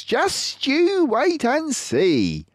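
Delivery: a drawn-out, knowing Mister Fox. Acted. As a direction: clever fox leader, pleased with himself.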